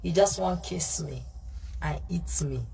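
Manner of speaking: ordinary talk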